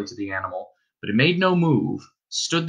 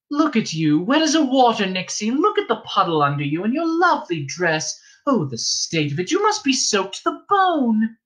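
friendly innkeeper's wife; 9 semitones higher than usual. Acted. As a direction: coax the girl in from the rain. Wife fusses over wet Star.

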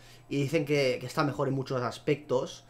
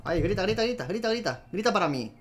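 high, rapid-fire urging; egging on; 4 semitones higher than usual. Real, not acted. Gamer urging the animal to roar, high and excited. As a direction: goad it on excitedly, pitched up.

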